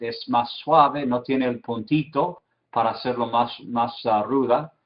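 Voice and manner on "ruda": normal talking voice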